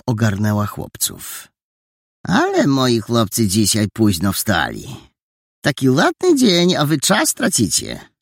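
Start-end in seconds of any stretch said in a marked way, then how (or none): 2.3-8.1 higher-voiced housekeeper chiding the boys; lightly scolding auntie; older woman teasing late risers; landlady.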